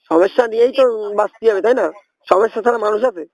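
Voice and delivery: ordinary delivery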